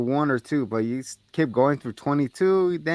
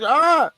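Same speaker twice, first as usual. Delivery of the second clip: startled yelp; 14 semitones higher, 6 dB louder than usual. Retold or imitated. Retold chainsaw scare.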